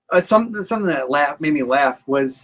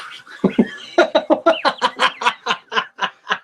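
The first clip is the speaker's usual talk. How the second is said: high drawn-out laugh; long giggle; 8 semitones higher than usual. Real, not acted. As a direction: laugh at your own joke. Co-host laughs after teasing.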